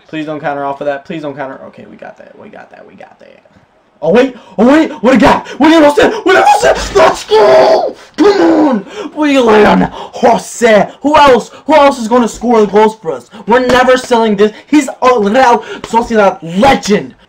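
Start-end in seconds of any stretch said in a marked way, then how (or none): 1.7-3.5 hushed relief. Real, not acted. Relief after defending. 5.6-17.1 high, loud joyful yelling; real elated cheering for the scorer; goal celebration. Real, not acted.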